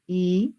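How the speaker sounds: I held long as "ee"; vowel pronunciation demo. Shown as a demonstration. Teacher having learners at home repeat, drawn-out I. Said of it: "Ahora pronuncia tú en tu casa".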